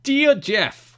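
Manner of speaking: high, loud hammed-up New Jersey accent. Shown as a demonstration. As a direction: read the letter as a goofy New Jersey fan. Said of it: "You must read this in a New, New Jersey accent".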